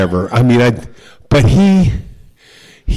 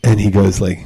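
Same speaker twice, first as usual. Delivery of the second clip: ordinary delivery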